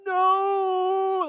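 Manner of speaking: long high, very loud "no"; drawn-out horrified shout. Retold or imitated. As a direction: cry out as disaster unfolds. Dad sees his throw go wrong.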